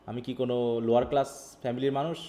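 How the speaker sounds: ordinary talk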